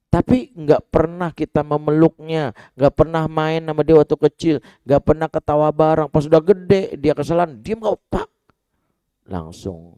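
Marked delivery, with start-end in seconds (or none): 0.1-8.2 loud, high, urgent preaching; forceful admonishing. Real, not acted. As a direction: hammer each point home to the parents. Preacher warns neglectful parents forcefully. (berkhotbah lantang dengan nada tinggi)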